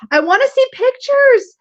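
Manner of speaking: high, drawn-out pleading whine; playful whining. Real, not acted. Co-host begging viewers to share deck pictures, whiny. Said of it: "God, you're so whiny".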